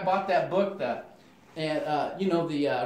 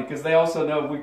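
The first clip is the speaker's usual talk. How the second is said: ordinary talk, nothing marked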